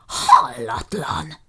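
slow, drawn-out indignant cry; stretched, outraged exclamation; outraged shout. A narrator's reading. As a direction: bellow it in disbelief. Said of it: "kiabált Jukrics".

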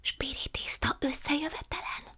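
secretive hushed whisper. A narrator's reading. Prue whispering to her aunt about the séance. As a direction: breathe it so no one overhears.

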